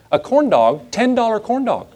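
high-pitched comic disbelief; mock rant. Preacher griping to congregation about theme park food, mock rant. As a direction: rant in squeaky outrage at the price. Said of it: "I'm just ranting real quick".

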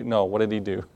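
ordinary talk, nothing marked

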